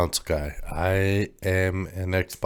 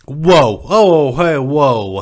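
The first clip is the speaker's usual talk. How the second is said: startled whoa; 8 semitones higher, 11 dB louder, much slower than usual. Real, not acted. Shocked reaction to joke.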